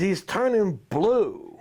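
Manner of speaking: mock worried trainer; lightly voiced sideline trainer; quoting the trainer. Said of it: "he says".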